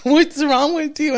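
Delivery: high, slow bewildered mom voice; a mother baffled by her sobbing daughter; mock-confused mom, drawn out; puzzled mom. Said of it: "mom's like".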